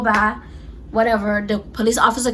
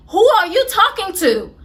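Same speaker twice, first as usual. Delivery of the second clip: higher, indignant retort; affronted comeback; 6 semitones higher than usual. Retold or imitated. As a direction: fire the question back, offended. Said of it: "Like that".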